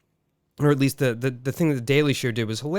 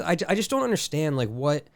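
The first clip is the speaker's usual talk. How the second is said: ordinary talk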